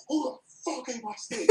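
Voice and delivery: mild mock furious yell; angry demand. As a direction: demand who ate the steak. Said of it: "I got so mad".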